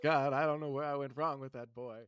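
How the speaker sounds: put-on a kindly old geezer at the pump; elderly man voice.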